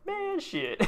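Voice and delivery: very high curse through laughter; laughing squeal. Real, not acted. Streamer still laughing at his mistake, squeaky giggle. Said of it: "I don't know why that's funny".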